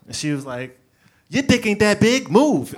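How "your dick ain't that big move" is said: loud, high mock demand; loud confrontation. Woman on the train to a seat hog, loudly confrontational. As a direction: order him to move at full volume.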